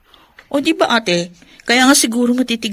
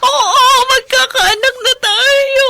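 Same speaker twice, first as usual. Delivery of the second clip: much higher, louder and slow, crying with joy; happy sobbing; 6 dB louder, much slower than usual. Acted. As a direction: let the tears carry the words out slowly. Wife confirms pregnancy, crying. (mabagal at masayang pag-iyak)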